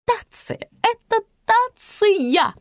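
very high, slow pronouncement; pompous high-pitched statement; haughty declaring. A narrator's reading. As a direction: declare it as if to a dunce, stretching the words. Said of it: "заявила тётка".

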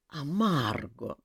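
slow, drawn-out reproachful call; a gentle rebuke to a friend. Acted. Hulian reproaching Margo for scaring the child, slow. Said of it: "укоризненно перебил его Хоулиан".

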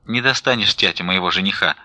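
ordinary delivery, nothing marked

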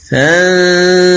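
فانفلق read slowly, the نون a long nasal hum before الفاء; slow recitation of فانفلق; nasal ghunna. Shown as a demonstration. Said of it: "أيضًا".

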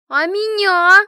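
high, loud indignant shout; jealous outburst at full volume; jealous yell. A narrator's reading. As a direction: yell it out, demanding not to be left out. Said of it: "с ревностью заорала Машка".

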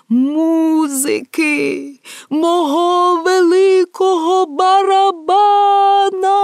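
high, long-drawn cry for music; desperate cry. Acted. Emperor cries for music to drown the voices, desperate cry. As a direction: call out loudly, stretching every vowel. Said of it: "кричав він".

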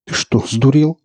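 incredulous rise at the end; astonished. A narrator's reading. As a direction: ask in disbelief, pitch climbing. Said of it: "изумлённо спросил Марк".

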